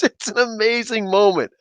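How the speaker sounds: ordinary talk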